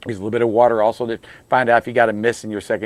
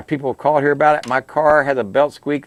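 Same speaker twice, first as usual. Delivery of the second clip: normal talking voice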